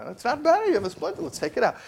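high, insistent parent voice; firm parent. Retold or imitated. Parent insists to the child that the splinter must come out, high and firm. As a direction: gently overrule the child.

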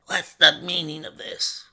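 villain's dry, rasping demand. A narrator's reading. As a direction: rasp the question out drily. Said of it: "in a dry, rasping tone".

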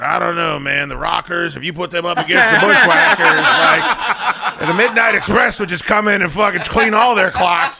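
high, loud imitation of a rambling wrestling fan; Adam. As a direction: argue fantasy wrestling matchups, oblivious and worked up. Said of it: "Adam's still talking about".

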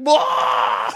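loud gloating bark of a laugh; triumphant shout. Retold or imitated. O'Connell jumping up in the bar, loud gloating shout. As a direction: shout it out as the prank lands. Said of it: "he's like".

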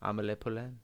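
Amelie as ah-muh-LAY before Poulain; quiet demo of Amelie Poulain; French film pronunciation. Shown as a demonstration. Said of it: "That's how they say it in the movie".

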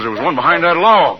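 he's just hit one; triumphant. Acted. Husband calls out to wife after the shot, excited. Jim shoots attacker.

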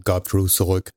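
ordinary delivery, nothing marked

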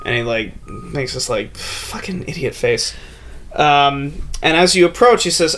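ordinary talk, nothing marked

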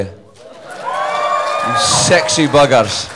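high, slow, drawn-out playful shout; cheeky shout. Real, not acted. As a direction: tease the crowd with a long cheeky call. Greeting the Phoenix crowd.

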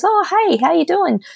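a breezy high-pitched impression of a friendly mentor; imitating Paris. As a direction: pop up high like a cheery mentor saying hi. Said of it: "He goes".

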